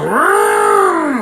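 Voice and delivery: high elephant-like trumpeting; imitated animal cry. Storyteller imitating the elephant he confronts, animal cry. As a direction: mimic an elephant, rising high. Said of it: "He goes".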